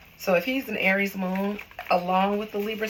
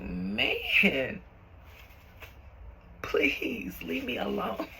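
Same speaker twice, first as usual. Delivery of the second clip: whisper; 5 dB quieter, much slower than usual. Retold or imitated. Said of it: "He was like".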